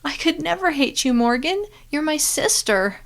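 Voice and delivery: in tears. Acted. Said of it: "Then she burst into tears".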